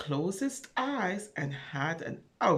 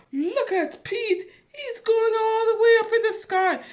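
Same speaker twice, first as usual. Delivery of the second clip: much higher and louder, excited; thrilled; 13 semitones higher, 6 dB louder than usual. Acted. Reader to children, thrilled as the rocket climbs.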